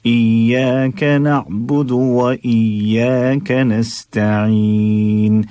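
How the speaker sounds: ordinary talk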